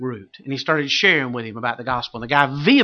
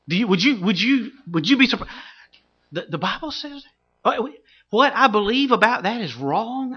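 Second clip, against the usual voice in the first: mock-startled, high-pitched questioning; taken aback; 9 semitones higher than usual. Listener retold questioning what the Bible says, taken aback. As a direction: imitate a thrown listener stumbling over the question.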